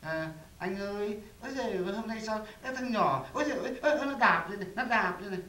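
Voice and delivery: high, loud imitation of a wife's yelps; pregnant wife complaining. Husband imitates his pregnant wife feeling kicks, high-pitched mock wailing. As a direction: mimic her crying out at the baby's kicks. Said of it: "nó cũng nói em là".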